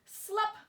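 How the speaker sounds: a sharp high slup; playful sound effect; popping slup sound. A narrator's reading. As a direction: pop the word out like a dropping hose. Sound effect of hose dropping.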